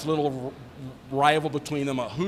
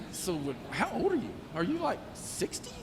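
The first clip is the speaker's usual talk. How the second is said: mock disbelief; 5 semitones higher, 7 dB quieter than usual. Teasing the older lieutenant.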